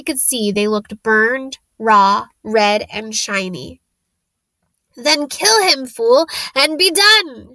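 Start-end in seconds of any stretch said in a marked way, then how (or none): 5.0-7.5 slow, louder furious command; a vicious screeching order; impatient master screeching at his servant; screeched. Acted. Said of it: "screeched Voldemort".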